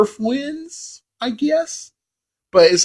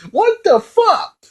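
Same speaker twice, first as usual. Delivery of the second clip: high, slow outraged disbelief; pitched way up, drawn-out WTF; incredulous; slower than usual. Real, not acted. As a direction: squeal it in total disbelief. Disbelief at forced Tyson fight.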